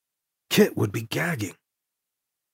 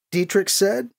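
ordinary delivery

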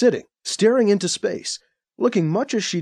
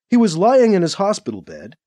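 usual speaking voice

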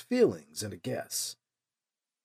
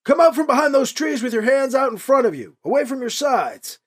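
higher, louder called command; calling out orders; 9 semitones higher, 5 dB louder than usual. A narrator's reading. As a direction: order it across the distance. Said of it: "the man called".

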